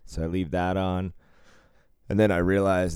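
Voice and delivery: usual speaking voice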